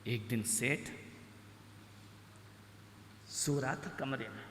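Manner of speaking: ordinary talk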